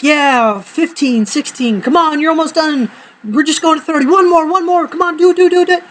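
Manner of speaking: high, urgent rep counting; gym partner cheering. Retold or imitated. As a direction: egg on a struggling friend, pitch climbing. Cheering partner through sit-ups.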